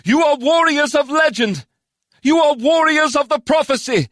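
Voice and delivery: high, loud rallying cry; rally the warriors, high and loud; ringing high-pitched proclamation; calling out. A narrator's reading. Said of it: "calling out to the warriors around her".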